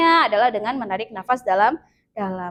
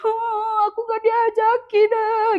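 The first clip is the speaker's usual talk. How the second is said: much higher, weepy, hammed-up whimper; mock teary sulk; 10 semitones higher, slower than usual. Pupil left out by friends, hammed-up weepy whimper. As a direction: play the kid about to cry. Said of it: "jangan langsung nangis".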